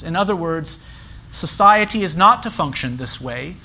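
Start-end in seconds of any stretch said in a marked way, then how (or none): none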